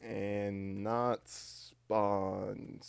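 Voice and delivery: extremely slow, stretched syllables; drawn-out dictation. Real, not acted. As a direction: drag out every syllable as if typing it. Finishing the typed title slowly.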